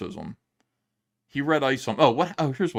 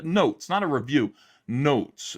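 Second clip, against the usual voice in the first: "notes" hit higher and falling, set against "not a review"; stress on notes; 4 semitones higher, 4 dB louder, slower than usual. Real, not acted. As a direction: punch "notes" both times, a touch higher. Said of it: "In other words, you know, a criticism".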